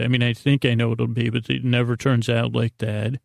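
normal talking voice

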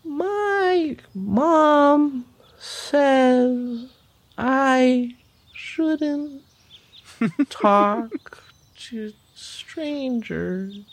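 put-on high, extremely slow little sloth child; small high voice, crawling along word by word; slow baby sloth. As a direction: drag every word out, with pauses between. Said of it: "You talk really slow".

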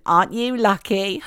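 lightly voiced posh royal; a hint of King Charles; King Charles impression. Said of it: "That was my King Charles impersonation".

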